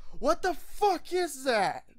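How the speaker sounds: high, loud, slow disbelief; shocked exclamation. Acted. Human seeing a griffin for the first time, shocked. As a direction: blurt it out in shock.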